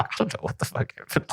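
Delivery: high, soft whispered aside; whispered disbelief. Retold or imitated. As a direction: breathe it out in shocked disbelief. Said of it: "he's just like".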